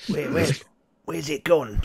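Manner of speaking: a jittery goblin pressing for news. Acted. Goblin behind rocks asking about the spider, high nervous voice.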